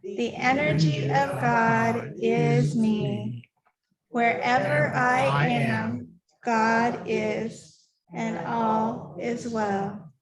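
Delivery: very slow, measured prayer recitation; solemn, drawn-out communal affirmation; recited group prayer. Acted. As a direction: lead the room through it phrase by phrase, unhurried. Leads congregation in prayer.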